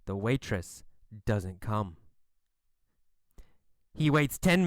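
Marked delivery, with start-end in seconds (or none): 0.1-2.0 low, hushed storytelling; understated letdown. A narrator's reading. Narrator tells children the waitress is missing, low and understated. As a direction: state the disappointment quietly and low. First failed wait, understated.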